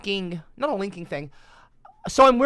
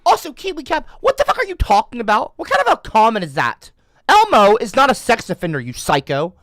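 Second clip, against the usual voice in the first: angry rant; 6 semitones higher, 8 dB louder than usual. Real, not acted. Ranting at chat commenter.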